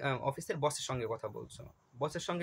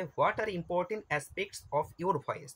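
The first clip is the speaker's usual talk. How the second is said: slow question read; 3 semitones higher, much slower than usual. Shown as a demonstration. Reading exam question aloud.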